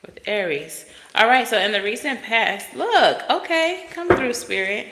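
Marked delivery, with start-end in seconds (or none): 1.1-4.9 high, eager call to Spirit; excited. Real, not acted. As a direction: coax the card out eagerly.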